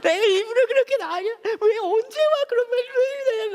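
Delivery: high, loud crying wail; wife crying on the phone over the missed flight; imitated sobbing wife. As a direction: wail like a heartbroken girlfriend. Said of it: "제 아내가 막 울기 시작하는 거예요".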